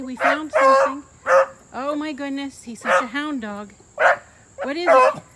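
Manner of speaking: loud, high, exasperated calling; calling the dog loudly. Real, not acted. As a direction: call out to the dog across the yard.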